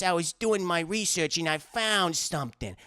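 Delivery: mock New York-New Jersey accent; imitation of a bad New York accent; faux New Jersey accent. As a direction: put on an odd, fake New Jersey voice. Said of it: "like, faux New Jersey, New York accent".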